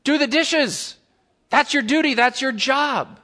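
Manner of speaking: high, emphatic parental command; insistent parent. Acted. Parent orders child to do chores, insistent.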